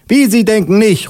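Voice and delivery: high-pitched indignant policewoman; high, affronted official. Acted. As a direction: challenge him in a high put-on voice. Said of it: "ruft die Polizistin".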